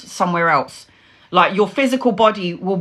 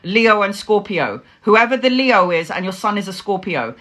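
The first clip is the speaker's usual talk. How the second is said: normal talking voice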